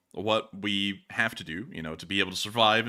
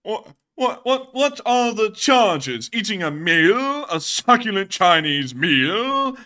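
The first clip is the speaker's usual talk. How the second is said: loud, high, slow theatrical outrage; hammed-up disbelief, stretching every phrase; indignant arrestee; 9 semitones higher, 8 dB louder, much slower than usual. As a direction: play an affronted orator being arrested. Acting out a famous arrest rant.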